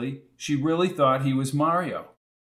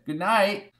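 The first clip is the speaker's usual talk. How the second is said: put-on old landlady; 7 semitones higher than usual. A narrator's reading. Landlady voiced.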